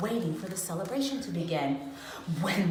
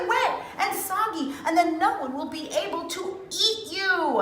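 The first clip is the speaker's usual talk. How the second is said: pleading call; 10 semitones higher than usual. A narrator's reading. Crowd warning the latkes off the river, high call. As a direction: call out before they hit the water.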